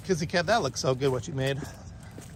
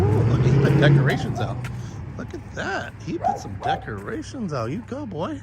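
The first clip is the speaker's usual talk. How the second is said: excited; 3 semitones higher, 7 dB louder than usual. Real, not acted. Excited at neighbour's decorations.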